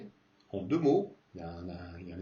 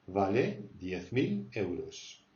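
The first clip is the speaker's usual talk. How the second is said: slow model answer; 3 semitones lower, slower than usual. Shown as a demonstration. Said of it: "teacher models slow answer".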